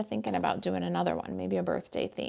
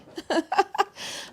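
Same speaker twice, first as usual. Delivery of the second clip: bright high giggle; high laugh; 11 semitones higher than usual. Real, not acted. Laughing at her own messy craft table, high giggle. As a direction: laugh at the pile of mess. Laughs after partridge joke.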